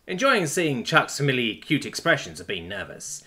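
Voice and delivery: ordinary delivery, nothing marked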